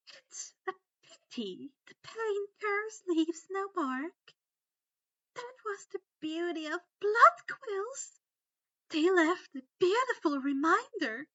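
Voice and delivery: high, loud, syrupy cruel voice; sickening sweet musing. A narrator's reading. Umbridge gloating over Harry, sickeningly sweet. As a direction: savour each cruel word like a sweet. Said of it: "Umbridge mused in a sickening tone".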